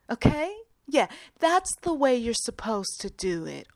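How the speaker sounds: high, slow, patronizing voice to an elder; condescending baby talk. Retold or imitated. Young worker talking down to older woman, patronizing. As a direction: talk down to her like a child. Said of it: "so now you want to talk like this".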